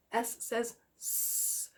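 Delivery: "S says S" with a long unvoiced hiss, slow; letter sound. Shown as a demonstration. Teacher to pupils sounding out the letter, slow hiss. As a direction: stretch the hiss of "S" without voice. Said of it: "the letter S".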